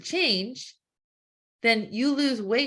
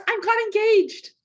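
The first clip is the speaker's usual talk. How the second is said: much higher and louder, squealing with joy; thrilled engagement news; excited announcement; 11 semitones higher, 6 dB louder than usual. Acted. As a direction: blurt out the big news.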